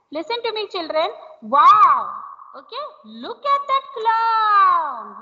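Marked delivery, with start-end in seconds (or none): 1.4-2.1 loud, delighted exclamation; excited wow. Shown as a demonstration. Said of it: "So you are expressing your feeling". 3.0-5.2 slow, drawn-out amazed line; slow, amazed demo; delighted exclamation. Shown as a demonstration. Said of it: "So you are expressing your feeling".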